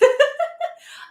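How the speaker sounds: high-pitched delighted laughter. Real, not acted. Teacher amazed by student's answer, delighted laugh. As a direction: laugh out loud, high and happy.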